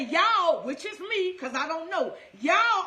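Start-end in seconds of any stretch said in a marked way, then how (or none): 0.0-0.6 y'all called out loud and falling; emphatic y'all. Shown as a demonstration. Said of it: "I'ma say it again". 2.4-2.8 y'all called out loud and falling; emphatic y'all. Shown as a demonstration. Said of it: "I'ma say it again".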